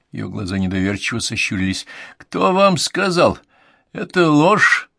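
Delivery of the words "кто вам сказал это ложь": high, loud, indignant denial; angry retort. A narrator's reading. Old woman rejects news of her death, angry retort. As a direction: cut them off crossly, pitched high. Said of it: "отрезала она сердито".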